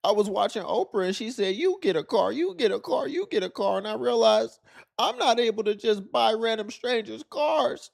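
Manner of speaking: high-pitched mock voice of an envious woman; whiny woman impression. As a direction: ham up an entitled complainer. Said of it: "she gonna be like".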